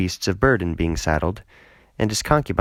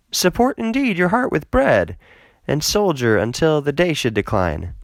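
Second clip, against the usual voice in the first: a lightly voiced older father, pitched up and pressing; father pleading; 8 semitones higher than usual. Father urging his son-in-law to stay, lightly voiced and pressing. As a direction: coax him to stay, a touch higher and eager.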